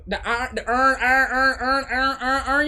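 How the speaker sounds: every word flattened to "urn", Baltimore style; imitated Baltimore accent. Streamer recalling a viral clip, mock Baltimore accent. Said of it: "when they was like the".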